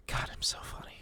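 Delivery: whispered aside. Shown as a demonstration. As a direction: breathe it as a whisper. Repeated catchphrase, now whispered.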